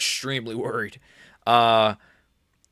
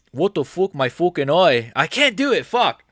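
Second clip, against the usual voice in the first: high, loud, pained English lad moaning about his eye; Tom Aspinall impression; 4 semitones higher, 4 dB louder than usual. Impersonating the retiring champ, loudly whining about his eye. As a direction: ham up a fighter cursing his injured eye. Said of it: "Impression of Tom Aspinall announcing his immediate retirement".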